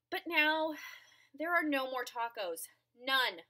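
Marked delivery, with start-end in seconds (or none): none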